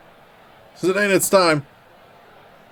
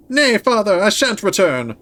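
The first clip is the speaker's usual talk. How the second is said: high, defiant maiden voice; high princess voice; 7 semitones higher than usual. Acted. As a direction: defy him in a high falsetto. Voicing the princess.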